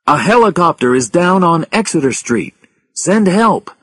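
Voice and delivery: loud, high-pitched radio alert; emergency call. A narrator's reading. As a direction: urgently call for help.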